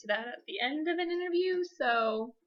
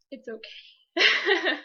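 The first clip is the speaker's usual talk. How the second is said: laughing it off; 4 semitones higher, 6 dB louder, much slower than usual. Real, not acted. Laughs at her own advice.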